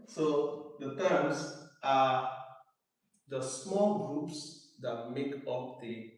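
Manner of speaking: slowly, word by word, for writing; slow dictation. Shown as a demonstration. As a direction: dictate slowly so students can write. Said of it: "let me know when you're done writing".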